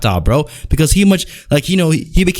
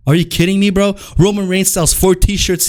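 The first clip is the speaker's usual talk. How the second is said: ordinary delivery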